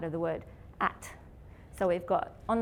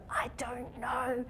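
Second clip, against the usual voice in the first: mock unsure; 4 semitones higher, 4 dB quieter, much slower than usual. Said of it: "where you go".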